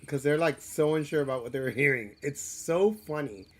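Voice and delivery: usual speaking voice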